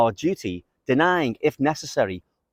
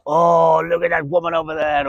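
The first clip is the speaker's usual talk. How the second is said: slave to desire; 4 semitones higher than usual. Acted. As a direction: act out someone distracted by lust. Voices a slave to passions.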